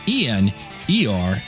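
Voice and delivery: ordinary delivery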